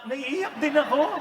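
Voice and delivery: lightly hammed crying husband; tearful husband. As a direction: play the husband welling up. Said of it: "Mamaya yung lalaki".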